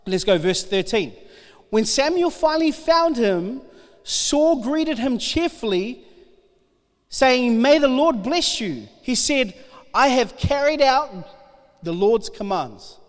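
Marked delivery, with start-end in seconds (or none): 1.7-13.0 steady, earnest Bible reading to the congregation; scripture reading. Acted. Preacher reading First Samuel to the congregation, earnest. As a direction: read it out like the passage matters. Reads Bible passage aloud.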